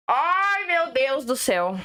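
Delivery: drawn-out exasperated groan on the words; weary, exasperated exclamation; exasperated sigh of words. Real, not acted. Exasperated at the criticism.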